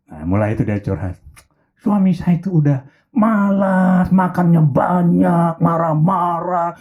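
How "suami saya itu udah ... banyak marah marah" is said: slow, dragged-out venting about husband; complaining slowly. Acted. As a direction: drag each complaint out. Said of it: "Curhatlah dia". (curhat pelan dan panjang mengeluhkan suami)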